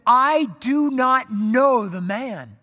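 loud, slow, word-by-word denial; emphatic denial. Acted. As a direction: swear it loudly, drawing out each word. Peter swears his emphatic denial.

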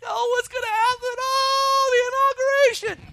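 high, loud mock wailing panic. Preacher mocking people panicking over the inauguration, high wail. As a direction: ham up the frightened crowd's cry.

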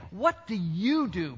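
slow, deliberate question with "you" underlined; stress on YOU. Shown as a demonstration. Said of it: "underline the word "you" with your voice".

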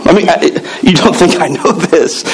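usual speaking voice

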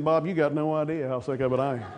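a playful southern God; southern accent. Pretend. Pastor voicing God amused in a southern accent. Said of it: "I don't think God has a southern accent".

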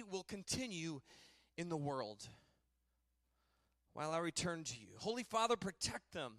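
solemn reading of scripture. Acted. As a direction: read it as a fervent prayer.

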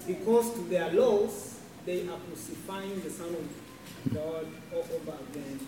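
ordinary delivery

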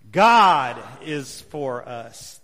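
"God" loud and long, the rest dropping away; stress on God. Shown as a demonstration. Preacher leading the congregation's read-aloud, stress on "God". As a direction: hit "God" hard and hold it, slowly. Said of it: "emphasize a different word".

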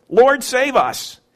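slow, earnest plea; pleading disciple. Acted. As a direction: call out to the Lord in trust. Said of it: "And then fear".

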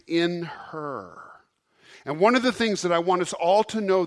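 loud, driving sermon voice; full-force preaching. Acted. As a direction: preach it to the back pews.

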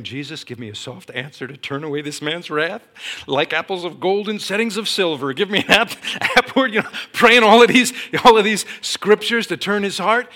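high, loud, comically desperate praying; frantic prayer. Retold or imitated. Teacher prays to turn away caller's wrath, frantic. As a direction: escalate into a panicked plea, breaking into laughter. Re-enacts panicked prayer during the call.

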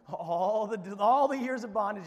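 hammed-up loud sarcastic confession; mock outburst. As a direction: burst out as if caught scheming. Mock outburst answering the complainers.

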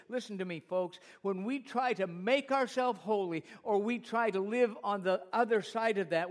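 loud, driving sermon delivery; impassioned preaching. Real, not acted. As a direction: preach it forcefully to the congregation. Preaches at full force.

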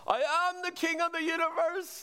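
hammed-up deluded king shout; madman screaming. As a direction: mock-scream like a deluded man on a chair. [name] repeats madman claim.

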